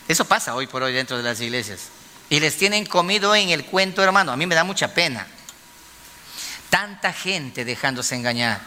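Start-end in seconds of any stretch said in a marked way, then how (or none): none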